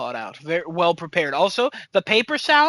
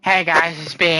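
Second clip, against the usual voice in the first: imitating Kevin; 4 dB louder than usual. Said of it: "he'll be talking like this".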